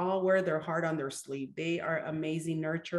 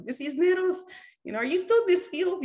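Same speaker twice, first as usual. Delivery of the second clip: much higher, louder mock student voice; imitating an eager student; 12 semitones higher, 5 dB louder than usual. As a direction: call out her name like a delighted former pupil. Former students messaging on Facebook.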